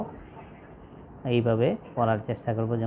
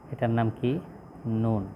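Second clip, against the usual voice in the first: ordinary talk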